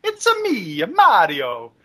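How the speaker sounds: falsetto cartoon Italian plumber; sing-song mock Italian, very high, swooping down; Italian accent Mario. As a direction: squeal it in a playful Italian accent. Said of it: "Mario the best in the Italian accent".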